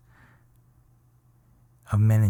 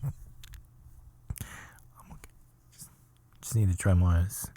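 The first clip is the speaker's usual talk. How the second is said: mock tearful; faster than usual. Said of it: "Once I cry it out".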